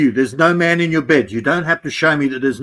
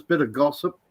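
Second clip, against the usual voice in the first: usual speaking voice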